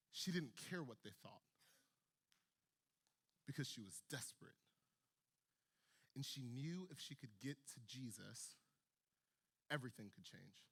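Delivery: preacher whispering the turning point; hushed. A narrator's reading. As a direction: pull them in quietly. Preacher drops to intense hush.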